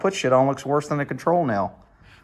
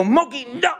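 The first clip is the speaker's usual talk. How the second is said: much higher mock yell of the cat's name; retold yell at the cat; 10 semitones higher than usual. Owner yelling at his cat not to jump, high retold shout. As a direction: shout him down as he leaps. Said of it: "I go".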